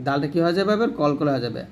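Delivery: ordinary talk, nothing marked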